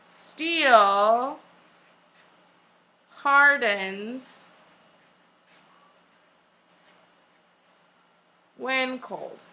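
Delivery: long pauses between the words; dictation with pauses. Shown as a demonstration. Teacher to class, pausing for copying. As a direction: let each word land before the next.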